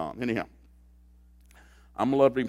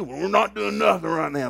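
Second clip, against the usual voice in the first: mock husband; 8 semitones higher, 7 dB louder than usual. Husband voice in skit.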